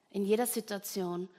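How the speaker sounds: ordinary delivery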